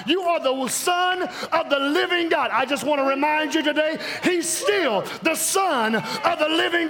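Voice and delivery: high, shouting preacher's climax; preaching at full force. Real, not acted. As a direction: build to a roaring declaration.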